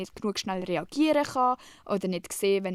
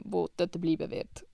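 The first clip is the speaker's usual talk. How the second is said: usual speaking voice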